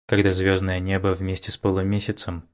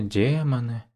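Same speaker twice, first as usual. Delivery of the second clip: dropping the voice on демоны; sultry drawl; 3 semitones higher than usual. Acted. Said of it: "томно протянула".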